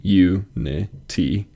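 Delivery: "U-ni-ti" slowly broken into three, weight on first and last; syllable stress demo. Shown as a demonstration. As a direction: separate each syllable of "U-ni-ti", middle one light. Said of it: "emphases at the beginning and the end".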